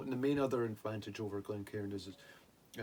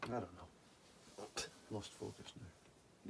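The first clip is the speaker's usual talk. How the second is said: quiet; 12 dB quieter, much slower than usual. Real, not acted. Trailing off after laughing.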